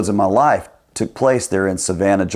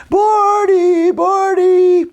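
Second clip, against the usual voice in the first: very high, far-carrying call of a name; distraught owner calling; 4 dB louder, much slower than usual. Retold or imitated. Owner searching the trees, calling desperately for the lost parrot. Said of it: "Buzzer going".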